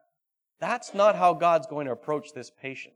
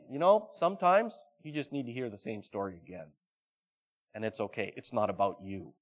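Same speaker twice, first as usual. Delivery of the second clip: gentle reassurance; 8 semitones lower, 7 dB quieter than usual. Acted.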